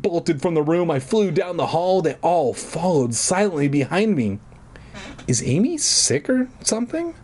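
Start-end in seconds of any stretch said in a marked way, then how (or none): 5.3-7.1 high-pitched puzzled brother; lightly hammed-up high character voice; young brother's question. A narrator's reading. Brother Jed asks parents about Amy.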